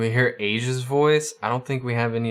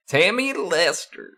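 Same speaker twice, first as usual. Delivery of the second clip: much higher southern twang; put-on southern accent; 8 semitones higher than usual. Shown as a demonstration. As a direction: try out a high-pitched southern character voice. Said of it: "I need to talk like that".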